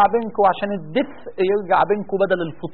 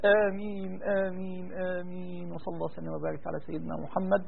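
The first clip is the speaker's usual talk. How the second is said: subdued closing; 2 semitones lower, 14 dB quieter than usual. Real, not acted. Imam closing the supplication with amen, subdued murmur. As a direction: let the prayer sink away quietly.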